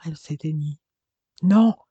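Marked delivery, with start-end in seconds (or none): 1.4-1.8 loud, high cry of refusal; sudden desperate shout; anguished howl. A narrator's reading. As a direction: cry out in grief. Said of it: "hurla Robert".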